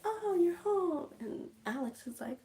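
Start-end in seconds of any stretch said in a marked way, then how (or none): 0.0-1.1 high, sweet fake-cheerful greeting; the aunt's high, syrupy hello; sugary aunt. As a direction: put on a falsely sweet welcome. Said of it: "she's like".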